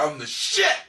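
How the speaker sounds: ordinary talk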